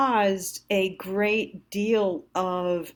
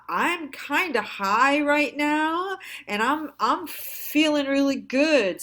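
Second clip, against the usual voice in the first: higher, slower giddy voice as if tipsy on vitamins; mock buzzed high; 7 semitones higher, 3 dB louder than usual. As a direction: ham up feeling pleasantly high. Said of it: "it was like".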